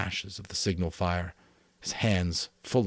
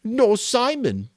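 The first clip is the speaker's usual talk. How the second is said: very high, loud, slow insistence; Piggy amplifying; 8 dB louder, slower than usual. A narrator's reading. Piggy adding Simon's name, high and slow. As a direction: push the point in a high whine. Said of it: "he amplified".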